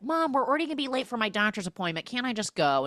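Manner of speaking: fast, high-pitched rush of pleading; imitated impatient son, quick and high; pleading kid. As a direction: beg Mom in one hurried breath. Voicing kid begging his mother.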